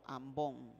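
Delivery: "ambón" stressed on the last syllable, the O; stress on final O. Shown as a demonstration. Teacher showing the class where the accent falls, stress on the O of "ambón". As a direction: land on the "ón" of "ambón", low and soft. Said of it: "Con acento en la O".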